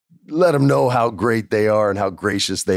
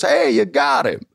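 put-on mentor voice, pitched way up; Bruce Pritchard. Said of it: "Bruce Pritchard comes back up".

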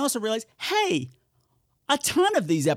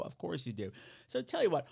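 normal talking voice

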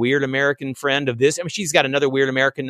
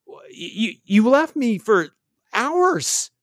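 higher-pitched retold complaint, held short of shrieking; medium-energy outburst; 5 semitones higher, slower than usual. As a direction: let a torrent of disbelief out at medium energy. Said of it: "kinda, like, medium energy with it".

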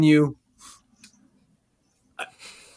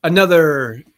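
lower, measured anchor voice; news anchor read; 5 semitones lower than usual. Acted. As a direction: read it like the nine o'clock news.

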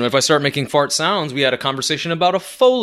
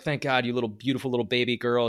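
put-on soft, relieved coo to the baby; tender relief; 3 semitones lower, 9 dB quieter than usual. Dad softly thanks his cleaned-up baby daughter, relieved. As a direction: murmur it gently like a relieved dad. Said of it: "I was like".

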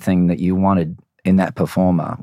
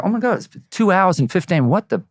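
high, astonished gasp of disbelief; shocked, like time flew; amazed; 9 semitones higher than usual. Retold or imitated. Fans surprised by show length.

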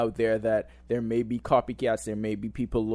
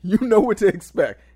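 higher, louder comedian impression; Dave Chappelle; 10 semitones higher, 10 dB louder than usual. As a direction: punch it like a stand-up bit. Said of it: "Like Dave Chappelle said".

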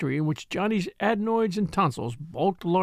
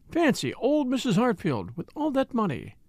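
excited wonder, pitched higher; 6 semitones higher than usual. A narrator's reading. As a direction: marvel at the news with mild excitement. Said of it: "She was very excited about it".

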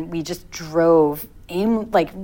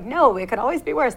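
put-on Jackie Mason accent; 7 semitones higher than usual. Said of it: "say it in the Jackie Mason accent".

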